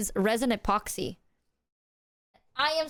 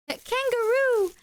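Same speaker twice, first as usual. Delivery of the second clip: delighted; 11 semitones higher than usual. Real, not acted. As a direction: squeal at the find. Delighted by kangaroo gift.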